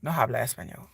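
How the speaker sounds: low, soft retold reply; imitating own reply. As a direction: say it low and softly, as an aside. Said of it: "I'll be like".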